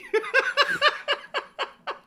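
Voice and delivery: high, breathless laughing fit; high giggling laughter. Real, not acted. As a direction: keep laughing, unable to stop. Laughing after I love you.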